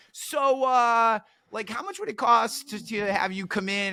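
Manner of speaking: high, hesitant mock asking; imitated tentative request. Former heckler asking the price of hiring speaker, mock tentative.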